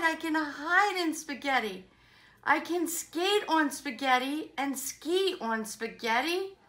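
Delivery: bouncy rhyming read-aloud; enthusiastic story reading. Acted. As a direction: relish each rhyme for young listeners.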